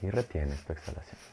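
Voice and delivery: softly pace each breath with long pauses. Acted. Counts breath cycles in meditation.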